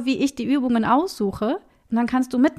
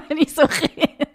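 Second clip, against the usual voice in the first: laughing sheepishly; 4 semitones higher than usual. Real, not acted. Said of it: "ich vergesse immer, dass das hier andere Leute hören".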